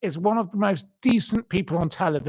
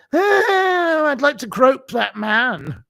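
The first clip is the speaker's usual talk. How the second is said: very high, loud, hammed-up lecherous squeal; mock lustful woman; 8 semitones higher, 5 dB louder, slower than usual. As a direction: shriek it like a leering panel guest on heat. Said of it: "they can just go on there and go".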